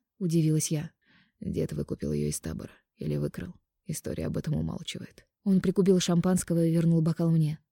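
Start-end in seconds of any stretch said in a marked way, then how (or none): 1.4-5.3 soft, low man's voice; quiet intimate male lover. Acted. Mark's story.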